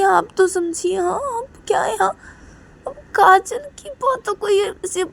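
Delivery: high, crying voice; tearful pleading. Acted. Reshma begs mom to understand, high and tearful. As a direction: sob out the plea to mom. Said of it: "Reshma बोलती कि".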